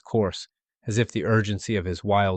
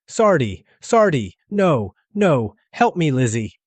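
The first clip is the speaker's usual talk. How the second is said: higher, louder pleading calls; mother's frantic cry; 6 semitones higher, 6 dB louder, slower than usual. A narrator's reading. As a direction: plead desperately as he slips away. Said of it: "his mother cried".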